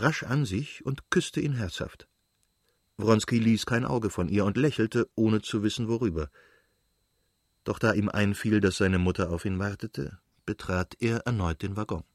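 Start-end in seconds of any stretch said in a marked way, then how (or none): none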